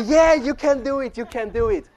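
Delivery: imitating an excited crowd; crowd cheering.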